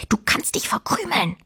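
breathy whispered hiss; hissed quietly. A narrator's reading. Fine hisses at the boy to get lost. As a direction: spit it out under your breath. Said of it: "zischte Fine leise".

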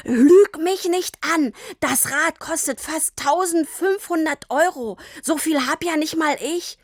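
high-pitched, indignant accusation; angry outburst. A narrator's reading. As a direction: explode at a lying child. Father angrily accuses daughter of lying.

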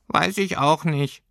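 high, slow little creature; squeaky furry creature. A narrator's reading. Creature has no answer for the girl, squeaky. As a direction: pipe it up slowly, at a loss.

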